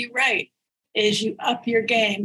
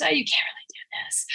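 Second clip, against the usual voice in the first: critic whisper; 5 semitones higher, 5 dB quieter than usual. Retold or imitated. Voicing the inner critic.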